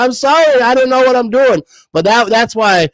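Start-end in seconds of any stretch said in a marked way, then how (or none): 0.0-1.6 flustered apology. Retold or imitated. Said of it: "And I was like".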